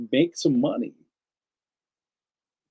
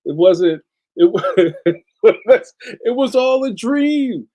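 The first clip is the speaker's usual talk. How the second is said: louder, laughing mock realisation, slowing; disillusioned newcomer; 7 semitones higher, 6 dB louder than usual. Mocking newcomers who find out it's business, laughing. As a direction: play the deflated newbie. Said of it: "people are like".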